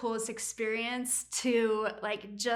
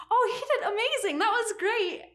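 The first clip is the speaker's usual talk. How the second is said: high, gushing praise; acted wifely cheerleading, pitched way up; supportive wife; 10 semitones higher, 4 dB louder than usual. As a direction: talk him up with exaggerated enthusiasm. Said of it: "I wanted to be a really supportive wife".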